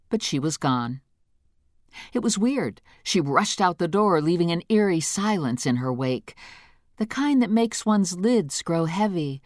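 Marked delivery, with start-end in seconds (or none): none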